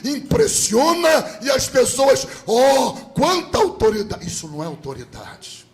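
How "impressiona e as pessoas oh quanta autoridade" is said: high, raised mock-preacher shout; hammed-up bellow of false authority. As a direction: imitate a preacher yelling to impress. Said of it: "Ele acha que berrando muito impressiona".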